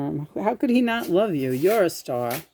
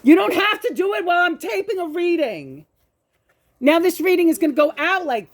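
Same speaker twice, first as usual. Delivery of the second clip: exasperated; 9 semitones higher than usual. Real, not acted. Arguing with husband.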